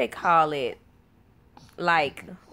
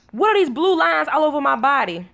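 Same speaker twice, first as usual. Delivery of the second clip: higher, mildly alarmed child imitation; lightly voiced son; 6 semitones higher, 4 dB louder than usual. Son asks mama about his body, mild alarm. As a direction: ask it like a puzzled kid. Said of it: "He was like".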